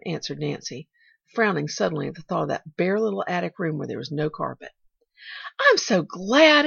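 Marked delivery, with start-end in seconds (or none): 5.6-6.7 slow, high, loud delight; exultant. A narrator's reading. Pollyanna hearing of carpets, exultant. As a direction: draw it out exultantly. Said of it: "exulted Pollyanna".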